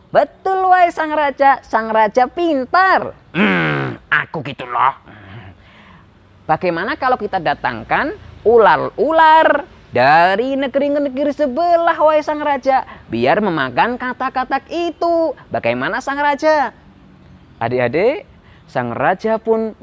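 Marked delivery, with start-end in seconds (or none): 0.1-3.2 butter up the king in a high voice; flattering minister. Acted. 3.3-5.0 lightly voiced self-satisfied king; smug king. King boasts after being flattered. 6.4-16.8 high-pitched minister pitching a plan; eager minister. Acted.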